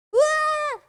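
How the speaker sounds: sudden high yelp; a shrill little startled cry; high squeal. Acted. Character yelps at the dungeon drop, high squeal.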